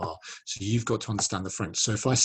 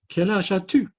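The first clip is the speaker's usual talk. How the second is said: high, loud puppet question; high-pitched character voice; Inès puppet voice; 10 semitones higher, 6 dB louder than usual. Acted. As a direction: squeak out the question as a little puppet. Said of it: "Matisse and Inez".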